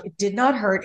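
ordinary talk, nothing marked

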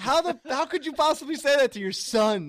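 mock outrage. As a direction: play up the wounded son. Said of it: "And I was like".

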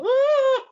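very high imitated shriek; short falsetto yelp; mock scream. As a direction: squeal in pretend fright. Imitates fright at the gun.

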